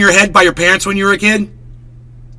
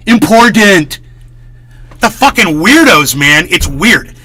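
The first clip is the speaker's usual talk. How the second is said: ordinary delivery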